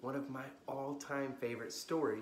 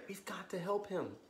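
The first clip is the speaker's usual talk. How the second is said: lively bedtime storybook reading; story narration; 5 semitones higher, 9 dB louder than usual. A narrator's reading. Storyteller reading to kids at bedtime, lively. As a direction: read it like a children's librarian at story time.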